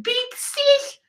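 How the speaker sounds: put-on very high, squeaky girl voice; giant weird smile. Grinning girl calling her big sister, very high voice. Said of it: "with her giant weird smile, she's like".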